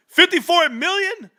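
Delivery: excited laugh. Real, not acted. Repeating the big number.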